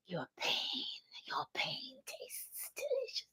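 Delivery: gloating, breathy whispered villain; creepy whisper. Retold or imitated. Fran gloating over her pain, in a creepy whisper. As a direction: savour each word in a hushed creepy whisper.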